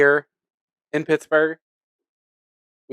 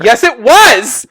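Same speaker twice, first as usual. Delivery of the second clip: excited; 10 semitones higher, 8 dB louder, much slower than usual. Real, not acted. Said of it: "excited agreement".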